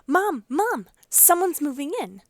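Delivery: high, loud, bursting with excitement; excited shouting. A narrator's reading. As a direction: call out the big news to Mom. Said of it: "shouting with excitement".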